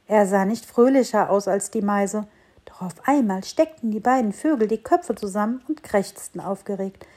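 ordinary delivery, nothing marked

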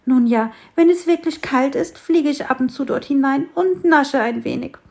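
high, loud eager bird voice; the little sparrow telling the tree about honey; chatty sparrow. A narrator's reading. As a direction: spill the secret happily.

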